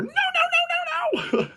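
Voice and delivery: very high, whiny pleading mock; mock her frantic crying plea; mocking Ellie's panic. Critic mocking Ellie's final scene, high whining panic. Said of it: "the final moment we see her".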